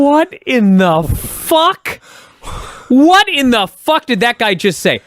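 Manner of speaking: slow, high, drawn-out what in the fuck; mock aghast, dragging each word; stunned disbelief. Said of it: "I was like".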